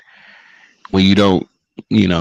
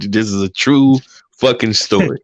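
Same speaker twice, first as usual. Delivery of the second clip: normal talking voice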